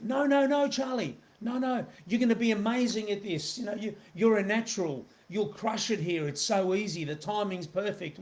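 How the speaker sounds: high, eager over-reassurance, pitch lifted well up; eager pleading. Retold or imitated. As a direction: oversell it to a hesitant prospect, eager and high. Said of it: "most people would respond with".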